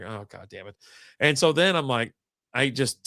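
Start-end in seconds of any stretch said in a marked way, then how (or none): 0.0-0.7 soft, low curse; muttered frustration. Retold or imitated. Texter getting an error message, muttering in frustration. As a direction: curse under your breath. Text to wrong number fails.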